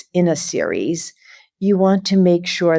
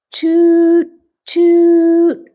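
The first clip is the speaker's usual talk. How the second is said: high, loud tooting horn noise; be the ferry's horn, high and loud; 14 semitones higher, 6 dB louder than usual. Acted.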